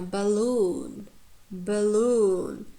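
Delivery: "balloon" said buh-LOON with long vowel; weak schwa start, strong drawn LOON in "balloon"; careful repeated "balloon", leaning on LOON; second-syllable stress. Shown as a demonstration. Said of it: "first syllable is unstressed, it has schwa".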